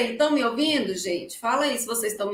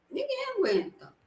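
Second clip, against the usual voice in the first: mock exasperation; 11 semitones higher, 7 dB quieter than usual. Repeated as an exasperated quip.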